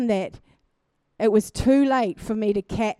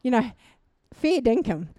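ordinary talk, nothing marked

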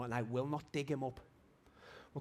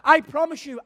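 shouted testimony; 23 dB louder than usual. Retold or imitated. Preacher reliving his testimony to the boss, shouting. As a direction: proclaim it at full force.

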